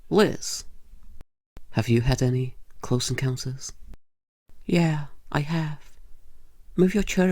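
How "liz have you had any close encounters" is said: ordinary delivery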